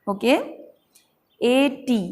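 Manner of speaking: ordinary talk, nothing marked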